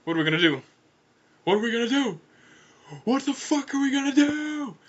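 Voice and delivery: loud, rising to a high wail; building panic. Acted. Big Zam learning a killer is loose, escalating panic. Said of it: "He started panicking".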